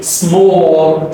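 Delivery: "small" with a long rounded aw and dark L; stretched sound. Shown as a demonstration. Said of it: "you stretch this out".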